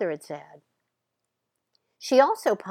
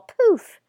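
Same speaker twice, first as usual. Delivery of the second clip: mock poof; 13 semitones higher, 7 dB louder than usual. Balloon bursting sound effect.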